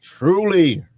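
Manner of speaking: high, loud drawn call; sponsor shout-out. Pretend. Host repeating the sponsor's name, loud shout-out. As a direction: call out the brand name proudly.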